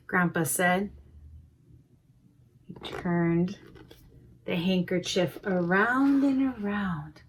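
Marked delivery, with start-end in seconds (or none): none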